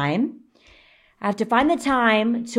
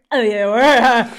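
slow, louder mock agreement; mocking slang imitation; 4 semitones higher, 6 dB louder, much slower than usual. Imitating people who say word, slow mockery. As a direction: drag it out, mocking the speaker.

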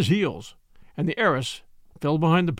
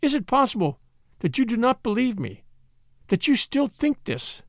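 faltering; 6 semitones higher than usual. A narrator's reading.

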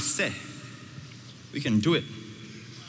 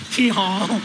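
playful mock hee-haw after a laugh; donkey bray. Preacher joking, mock donkey bray. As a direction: bray like a gold-laden donkey. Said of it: "The donkeys were like".

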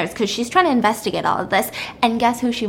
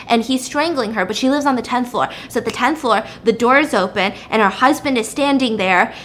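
usual speaking voice

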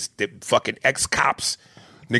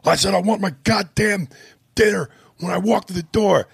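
angry father; 10 semitones higher than usual. Retold or imitated. Dad home from a bad day, yelling for dinner in a hammed-up rage. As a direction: yell like a furious dad home from work. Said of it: "everybody gets beat up".

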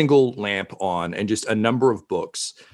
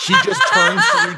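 laughing; 6 dB louder, faster than usual. Real, not acted. GM cracks up mid-narration.

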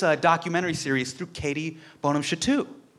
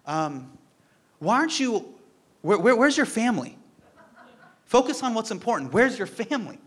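Norman's interruption; 7 semitones higher than usual. Retold or imitated. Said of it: "And he goes".

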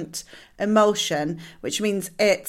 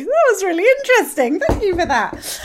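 higher, louder, laughing tease; exaggerated interest, pitched up through laughter; teasing mock praise; 8 semitones higher, 6 dB louder than usual. Co-host ribbing her partner's long answer, teasing mock praise.

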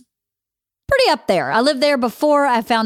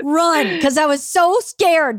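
usual speaking voice

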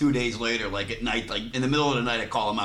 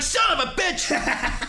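high, drawn-out mock outburst; exasperated curse; much slower than usual. Comic retelling prank victim's reaction, exasperated curse. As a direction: imitate a fed-up man cursing, slow and high.

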